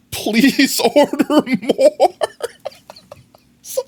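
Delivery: high, drawn-out tearful whine through laughter; hammed-up crying plea; mock desperate pleading. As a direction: exaggerate a squeaky, weepy beg, stretching the words. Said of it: "they didn't actually ask like that".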